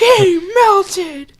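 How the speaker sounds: very high squealed cry; high-pitched he melted; shocked squeal. Real, not acted. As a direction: shriek it in disbelief. Shock at the scene.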